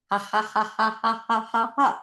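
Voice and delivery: playful practice laugh; exercise laughter. Shown as a demonstration. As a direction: laugh along deliberately while miming. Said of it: "breathe and laugh and move along".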